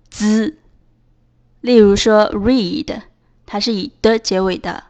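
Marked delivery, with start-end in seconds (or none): 0.1-0.6 "z" as d and s fused into one dz; merged dz sound. Shown as a demonstration. Teacher showing d and S together, "z" as dz. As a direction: say "z" as a single short dz buzz. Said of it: "共同发音".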